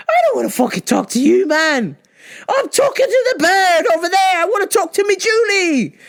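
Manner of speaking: high, wailing mock protest; hammed-up frustrated whine; retold exasperated outburst. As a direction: plead and rant in a high, strained wail.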